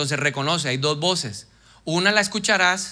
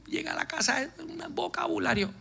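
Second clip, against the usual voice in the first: high, soft whining complaint; high plaintive mock wife; whiny woman; 10 semitones higher, 10 dB quieter than usual. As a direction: mimic a fed-up wife, pitched up and quiet. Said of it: "Mujeres que me dicen".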